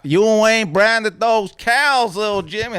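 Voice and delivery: hardball Aunt Sally. Acted. Aunt Sally to little Jimmy, hardball Texan scolding. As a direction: scold in a drawn-out Texas accent. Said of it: "My, my Aunt Sally, she was a real hardball".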